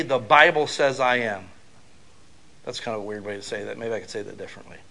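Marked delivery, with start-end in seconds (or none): 2.6-4.8 muttered fast and much softer; fast, soft self-correction; quick quiet aside. Real, not acted. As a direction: throw it away under your breath.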